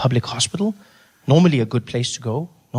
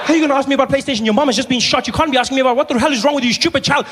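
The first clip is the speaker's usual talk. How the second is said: exasperated rant; 11 semitones higher, faster than usual. Retold or imitated. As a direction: scold the kid in disbelief. Yelling at brother in hospital.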